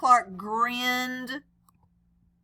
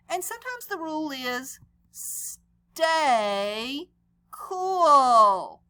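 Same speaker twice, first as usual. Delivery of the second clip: teacher stating the rule; 3 semitones higher, 5 dB louder, much slower than usual. Acted.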